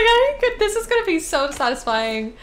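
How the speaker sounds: very high, laughing put-on voice; giddy mock teen squeal; laughing mock naive voice. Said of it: "I was like".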